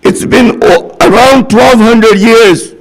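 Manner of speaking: high, drawn-out preacher's cry; slow, ringing sermon lament; impassioned preaching. Acted. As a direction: call out each phrase slowly and fervently, pitched high.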